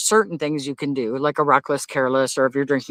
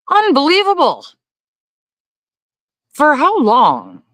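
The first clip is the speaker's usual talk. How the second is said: shocked; 12 semitones higher, 5 dB louder, slower than usual. Real, not acted. Shocked by US warrant rule.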